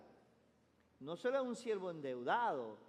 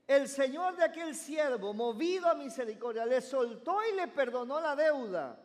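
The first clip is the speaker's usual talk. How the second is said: loud, higher proclamation of the parable; preaching scripture reading; 6 semitones higher, 8 dB louder than usual. Acted. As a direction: proclaim the text to the congregation. Rereads verse with force. (lectura bíblica proclamada con fuerza)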